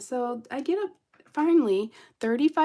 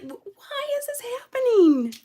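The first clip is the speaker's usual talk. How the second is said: exasperated disbelief; 14 semitones higher, 7 dB louder than usual. Retold or imitated. Disbelief after being refused help.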